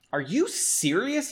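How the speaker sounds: high-pitched incredulous question; disdainful cry. Acted. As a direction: ask it high, with understated disdain. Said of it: "he cried without holding his disdain".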